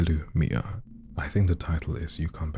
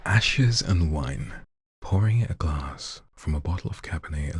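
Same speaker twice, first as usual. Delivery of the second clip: spoken-word poetry whispered to an audience; dramatic poem reading. Acted. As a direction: lean in and whisper the verse. Reads a poem aloud.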